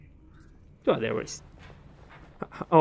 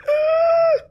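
excited gasp. Real, not acted. Player finding resin, overjoyed gasp. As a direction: gasp sharply with joy. Finds she can take resin.

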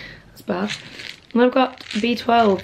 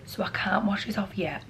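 whisper; 9 dB quieter than usual. Real, not acted. Whispering, mum in shower.

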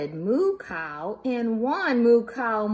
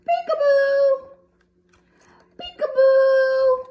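high, loud peekaboo; high loud slow peekaboo; peekaboo reveal; 14 semitones higher, 9 dB louder than usual. Acted. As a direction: draw out the surprise.

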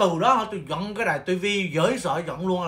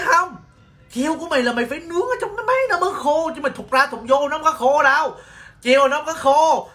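high-pitched, emphatic retold insisting; insistent protest; 8 semitones higher than usual. Nail tech to scared client about the lamp, high insistent protest. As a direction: plead the case in a raised pitch.

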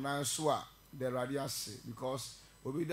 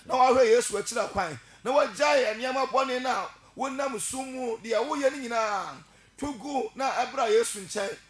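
loud, higher fiery preaching; preaching at full force; 5 semitones higher, 6 dB louder than usual. Real, not acted. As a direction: call the congregation to repent, full voice. Preacher rises to full altar call.

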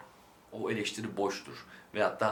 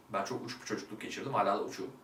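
ordinary delivery

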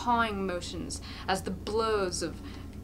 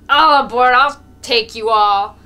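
put-on roaring drunk bellow, much louder; drunken shout; 4 semitones higher, 18 dB louder than usual. A narrator's reading. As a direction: bellow the invitation like a drunk carter. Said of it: "Mokolka shouts again".

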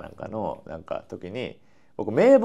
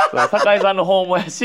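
笑いながら; 7 semitones higher, 6 dB louder than usual. Real, not acted. Laughs about wedding absences.

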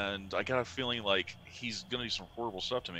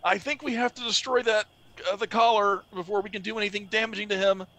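higher, louder in-character voice; 12 semitones higher, 7 dB louder than usual. Acted. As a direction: speak as Relwyn, worried and urgent. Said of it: "Relwyn's like".

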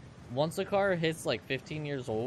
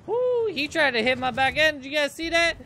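high, loud startled woo; excited outburst; 12 semitones higher, 7 dB louder than usual. Real, not acted. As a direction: yelp it to the chat, thrilled. Rival bumps his car.

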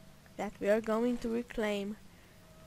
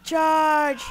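loud and higher, a full yell of charge; shouted battle cry; 5 semitones higher, 11 dB louder than usual. Retold or imitated. Friend yelling at the elf girl to charge, a full shout. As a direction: bellow it to launch the attack. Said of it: "he said to me".

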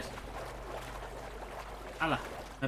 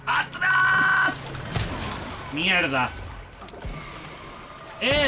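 panicked shout; 7 semitones higher, much slower than usual. Real, not acted. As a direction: yell as it lunges. Sudden attack, shouting.

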